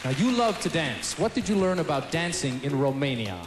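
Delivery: usual speaking voice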